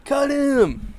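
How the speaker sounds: high, long fan heckle; mock fan outrage. Playing fans calling for a player's release, high mock outrage. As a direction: holler it high like a disgusted fan in the stands. Mimics fans demanding a cut.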